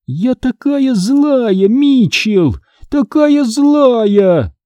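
very slow, high, drawn-out ghost voice; gloating ghost. Acted. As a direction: stretch each word like a gleeful evil spirit. Ghost in car taunts Mitchell. (злорадный призрак, медленно и высоко)

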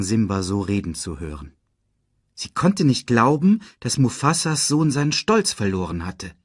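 usual speaking voice

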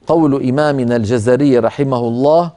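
ordinary delivery

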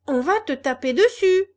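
loud menacing warning; threatening. Acted. Boys warn the puppet, loud menace. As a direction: growl a warning at him.

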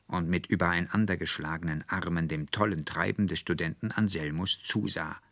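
ordinary delivery

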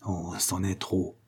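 suppressed furious growl; muffled growl. A narrator's reading. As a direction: growl it under the breath. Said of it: "gronda-t-il sourdement".

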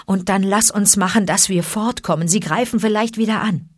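ordinary delivery

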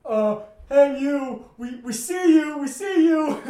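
loud, high panicked shout; freaking out. Retold or imitated. Roommate spots a man on the pier, acted-out freaked shout. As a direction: call out in acted panic at a lurking stranger. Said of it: "Kevin starts freaking out this time".

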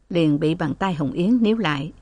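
usual speaking voice

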